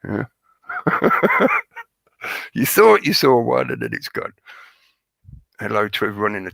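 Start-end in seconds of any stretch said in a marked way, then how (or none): 0.7-1.8 high laugh. Real, not acted. Laughs at own joke.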